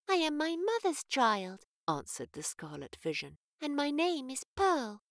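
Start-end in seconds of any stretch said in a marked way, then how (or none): 0.1-1.7 put-on a child's voice, much higher and slow; little girl. Little girl Pearl answers. 3.6-5.0 put-on a child's voice, much higher and slow; little girl. Little girl Pearl answers.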